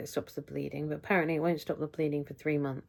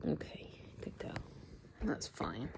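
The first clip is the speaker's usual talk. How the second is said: hushed, tender whisper; whispered praise; 3 semitones higher, 10 dB quieter than usual. Real, not acted. Praising her kneading cat in a whisper.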